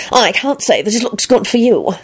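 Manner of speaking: prim moral reproach; disapproving. A narrator's reading. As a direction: scold her like a pompous prig. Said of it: "Percy peered at her disapprovingly".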